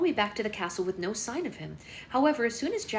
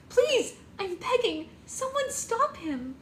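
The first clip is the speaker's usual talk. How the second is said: much higher, slow, desperate begging; hysterical pleading; slower than usual. A narrator's reading. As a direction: drag out the plea, sobbing. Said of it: "Red hysterically cried".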